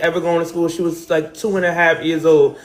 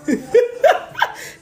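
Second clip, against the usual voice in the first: high laugh. Real, not acted. Laughing at her own pictures.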